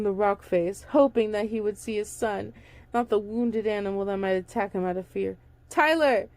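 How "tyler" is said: calling out louder and higher; yelled. A narrator's reading. Father searching the rocks for his son, calling out. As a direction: call his lost son's name. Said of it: "he yelled".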